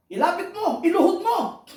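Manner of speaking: high, loud preacher's build; rally the congregation to pray; preacher shouting each trial in turn; fiery preaching. Acted. Preaching at full force.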